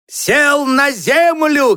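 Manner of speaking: high, drawn-out storybook cry; high, stretched announcement; boy calling out. A narrator's reading. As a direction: call it out slowly in a high voice. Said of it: "крикнул Мишка".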